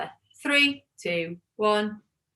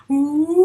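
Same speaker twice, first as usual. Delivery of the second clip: water wheel woo; 4 semitones higher, 5 dB louder than usual. Shown as a demonstration. Teacher demonstrating the water wheel oo, a quick launched woo. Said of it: "and it goes like this".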